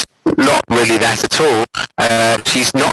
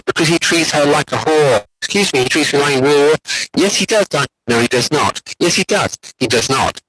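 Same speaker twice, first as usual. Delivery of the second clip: put-on childish bickering; 5 semitones higher than usual. Lecturer reads out adults arguing like children, childish bickering. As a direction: bicker back and forth like children. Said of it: "It's almost like children".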